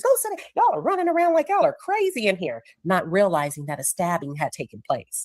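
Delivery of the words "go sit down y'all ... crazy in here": very high-pitched retold scolding; mock yelling. Coach sending kids to sit down, mock yelling. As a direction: imitate a coach fussing at rowdy kids. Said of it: "He's yelling at the kids".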